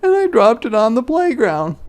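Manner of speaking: put-on a boy telling on her; high loud tattling boy; crying out Martin. Martin voiced.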